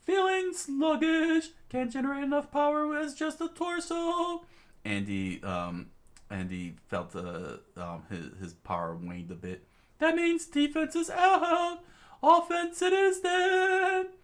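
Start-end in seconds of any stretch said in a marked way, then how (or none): 0.0-4.5 high strained hero voice. Acted. Andy weakened, talking to himself in a high strained voice. 10.0-14.1 very high, slow complaint; high strained hero voice. Acted. Andy weakened, talking to himself in a high strained voice.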